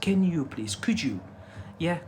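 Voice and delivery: ordinary delivery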